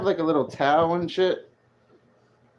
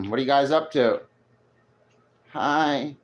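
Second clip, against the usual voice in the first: ordinary talk, nothing marked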